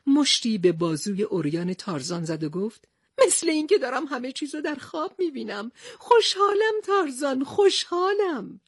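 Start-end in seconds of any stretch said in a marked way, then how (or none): 3.3-8.6 high, slowing happy cries; overjoyed. Acted. Captain greeting Tarzan at last, overjoyed. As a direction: savour the joy of reunion.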